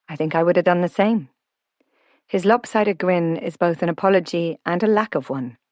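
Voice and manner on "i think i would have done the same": usual speaking voice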